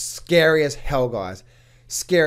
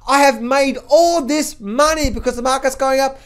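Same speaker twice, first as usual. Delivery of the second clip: mock greedy investor; 7 semitones higher than usual. YouTuber mocks an investor thrilled the market keeps rising, higher voice. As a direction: gush like a naive bull. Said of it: "this is exactly like".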